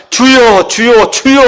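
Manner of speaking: loud, high imitation of congregational calling; mock threefold prayer cry. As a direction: cry out repeatedly, aping empty fervor. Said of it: "주여 삼창 해대는 거".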